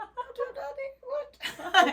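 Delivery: high, slow, laughing movie voice; Austin Powers film impression. Co-host quoting Austin Powers at her friend, high and laughing. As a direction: draw it out high, cracking up. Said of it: "You've seen Austin Powers, where he's like".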